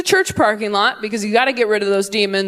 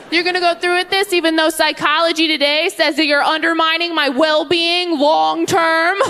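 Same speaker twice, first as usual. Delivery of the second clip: higher, know-it-all whine; smug kid; 7 semitones higher than usual. Retold or imitated. Bratty kid lecturing parent, higher smug tone.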